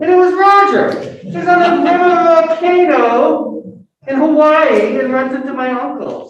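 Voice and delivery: high, loud, delighting in the coincidence; real amazement building to the reveal; excited punchline. Real, not acted. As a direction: land the twist with real excitement.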